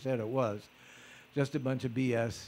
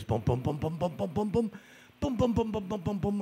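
quick rhythmic "boom boom" footfalls; imitated trotting footsteps; 4 semitones higher than usual. Being trotted blindfolded through the house, imitated trotting. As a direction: pound out the trot in booms.

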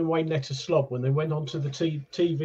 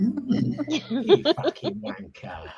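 laughing insult; 7 semitones higher, much slower than usual. Acted.

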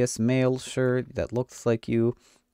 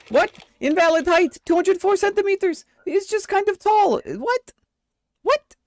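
much higher, squeaky, faster mock voice; 4 dB louder than usual. Inspector squeaks at the too-tall entrant's height. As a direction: pinch the voice up high like a cartoon clerk. Voicing a baffled squeaky official.

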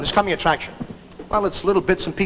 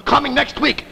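high, booming mock-trailer voice; movie trailer announcer; 5 semitones higher, much slower than usual. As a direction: hype it like a bombastic coming attraction, drawing out the big words. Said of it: "this gangster coming attraction looked to me".

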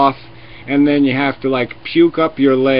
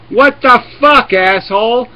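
imitated outraged yell; 7 semitones higher, 4 dB louder than usual. Imagined onlooker yelling at the experimenter, loud and outraged. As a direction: blow up at the fool doing it. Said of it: "you would say".

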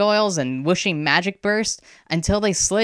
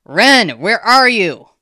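loud, higher searching shout; desperate call; 6 semitones higher, 9 dB louder, slower than usual. A narrator's reading. Girl calling for her missing friend, desperate shout. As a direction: shout out for someone lost.

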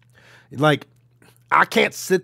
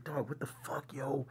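retold whisper; 14 dB quieter than usual.

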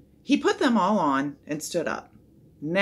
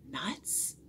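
much softer, hushed question; whispered echo; 12 dB quieter than usual. A narrator's reading. Onlookers echo the strange word, whispering. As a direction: murmur "Nuts?" under the breath, questioning. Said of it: "whispered the others".

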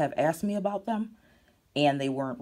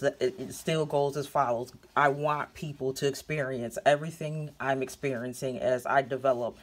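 ordinary delivery

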